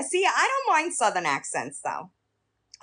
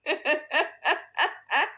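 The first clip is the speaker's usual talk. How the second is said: high giggle in short bursts; real amused cackle; laughing; 3 semitones higher than usual. Real, not acted. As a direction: crack up at your own remark. Laughs after teasing remark.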